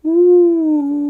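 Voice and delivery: very high, loud hoot; owl hoot. A narrator's reading. Owl calling from the hemlock, loud hoot. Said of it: "called the owl".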